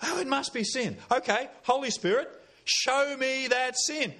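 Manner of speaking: high-pitched acted prayer of discovery; earnest realisation. Preacher models a believer asking the Holy Spirit, acted realisation. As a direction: plead it high, drawing out the request. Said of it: "you go".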